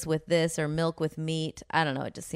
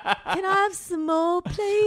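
much higher, slow pleading orphan; Oliver begging; 12 semitones higher, slower than usual. Retold or imitated. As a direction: beg for more like a timid orphan boy. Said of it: "He's like".

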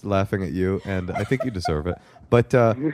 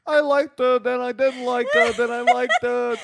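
high mocking impression of a waffling guy; mocking the caller. Host mocking the caller's flip-flopping, high and whiny. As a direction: play him high, whiny and indecisive. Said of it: "That guy was so full of it".

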